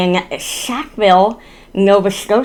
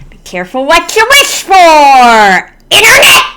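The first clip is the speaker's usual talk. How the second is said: mock warning shout; 6 semitones higher, 4 dB louder than usual.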